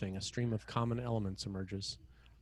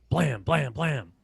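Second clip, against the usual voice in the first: punchy, louder gunshot sounds; mimicked gunshots; 4 semitones higher, 8 dB louder, much slower than usual. A narrator's reading. Narrator voices the gunfire in the building, punchy. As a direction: spit each blam like a shot.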